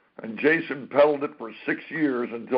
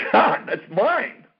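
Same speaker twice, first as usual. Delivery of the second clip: slow, high and loud, claiming it as his own; a retold burst of indignation at his friend; indignant protest; 8 semitones higher, 4 dB louder, much slower than usual. Telling his friend the role is his, drawn-out and indignant.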